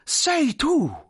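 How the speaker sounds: high, slow, effusive greeting; drawn-out cries of joyful recognition; delighted exclamation. Acted. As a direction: gush over a long-lost friend. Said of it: "esclamò il grosso, scorgendo lo smilzo".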